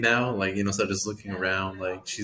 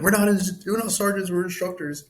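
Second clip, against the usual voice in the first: imitating drill instructors; 10 semitones higher than usual. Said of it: "they're big on that".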